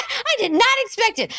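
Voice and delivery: ordinary delivery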